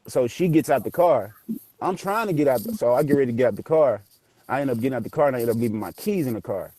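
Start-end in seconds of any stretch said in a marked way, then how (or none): none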